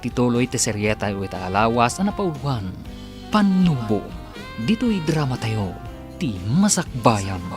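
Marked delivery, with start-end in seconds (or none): none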